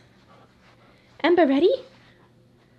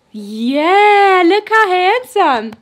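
excited dog praise; 6 semitones higher, 11 dB louder, much slower than usual. Real, not acted. Showing off dog in new collar.

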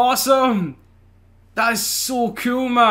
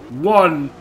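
ordinary delivery

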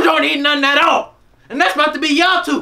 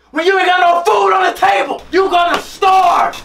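angry yelling; 5 semitones higher, 5 dB louder than usual. Acted. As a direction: yell it at the kids. Mom yelling at ungrateful kids.

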